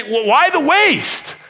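high, slow, scornful "why the waste"; indignant disciples. Acted. Pastor voicing the grumbling disciples, high and slow. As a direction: sneer it like an offended disciple.

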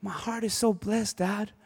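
a son's soft, reverent aside to his dad; quoting his young son. Retold or imitated. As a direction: voice the awed child gently and quietly. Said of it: "he's like".

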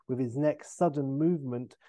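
usual speaking voice

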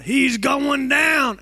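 loud, very slow, drawn-out yell. Retold or imitated. Friend yelling as he falls to the floor, loud slow yell. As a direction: call it out slowly like a ringside announcer. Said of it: "Garth yell out".

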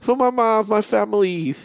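high, whiny lisped plea; lisping impression. Friend mimicking her asking for gifts for mom, lisping whine. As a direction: beg in a mock little-kid lisp. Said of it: "that's another impression".

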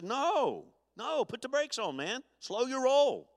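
high-pitched, exclaimed mock protest; emphatic objection. Paul answering those who want license, emphatic objection. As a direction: pull them up short, pitched high.

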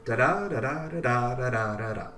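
five da-da pairs, each second da stressed, fading; iambic pentameter beats. Shown as a demonstration. Poet proves her good line is iambic pentameter, chanting. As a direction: tick off five iambs. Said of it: "really good iambic pentameter".

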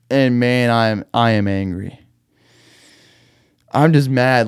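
ordinary talk, nothing marked

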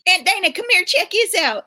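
put-on high, excited little-boy call; imitating nephew's yell. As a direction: call out like an eager small nephew. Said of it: "he literally yelled to me".

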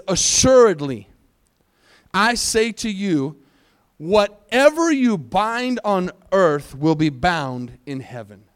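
slow, weighty Bible verse delivery; preacher reading out a promise slowly; scripture reading. Acted. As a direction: proclaim the verse deliberately, phrase by phrase. Reads scripture aloud.

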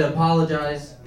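low, soft, far-off voice of JFK. Retold or imitated. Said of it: "J F K said".

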